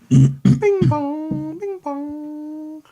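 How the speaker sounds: high, sung two-tone doorbell chime. Retold or imitated. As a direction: imitate an electronic doorbell ding-dong. GM imitating doorbell.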